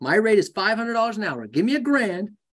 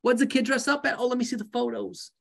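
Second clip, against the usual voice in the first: high, bubbly chatty friend voice; gushing acquaintance; 7 semitones higher, faster than usual. Acted. A high bubbly voice for a friend who corners you. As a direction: gush like an old friend spotted in the street.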